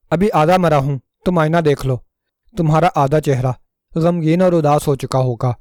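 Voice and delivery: ordinary delivery, nothing marked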